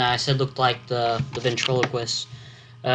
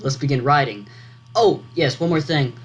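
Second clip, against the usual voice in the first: normal talking voice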